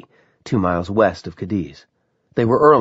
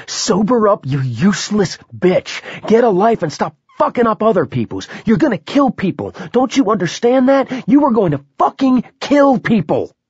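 screaming; 12 semitones higher, 4 dB louder than usual. A narrator's reading. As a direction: scream it into her face. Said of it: "he screamed into her frightened face".

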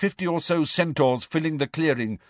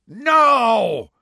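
loud, high drawn-out roar; 11 semitones higher, 7 dB louder than usual. A narrator's reading. Centaur roaring in the boy's ear. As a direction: roar the word out long. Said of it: "roared a voice in Harry's ear".

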